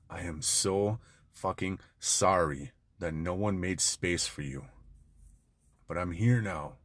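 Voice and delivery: put-on soft, gentle words to a hurt little boy; tender reassurance. Shown as a demonstration. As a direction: speak low and tender, like comforting a child. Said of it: "Look him in the eyes and say this".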